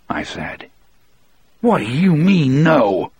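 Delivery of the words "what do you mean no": high, sharp outburst of fury; raging disbelief, pitched up high; furious. Acted. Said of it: "he asked me furiously".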